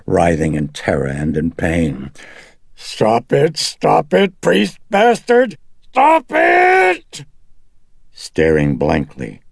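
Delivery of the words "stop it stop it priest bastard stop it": high, loud screams building in pitch; agonized demon shout. Acted. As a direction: shriek for the priest to stop. Demon writhing in terror and pain.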